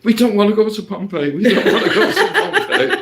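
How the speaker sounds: high, laughing mock whine; high squeaky mock plea; mimicking the Chinese tourists. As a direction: squeak it out through laughter, mocking their protest. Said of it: "they kept saying".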